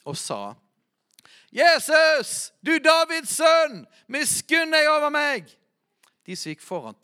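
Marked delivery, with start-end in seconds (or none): 1.5-5.5 high, loud cry for mercy; shouted plea. Retold or imitated. Blind beggar shouts to the passing Jesus, a loud high plea. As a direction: call out to him like a desperate beggar. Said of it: "Og han ropte".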